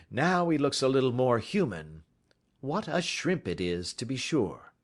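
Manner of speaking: polite detective taking charge, lightly voiced; lightly voiced Holmes. A narrator's reading. Holmes commanding.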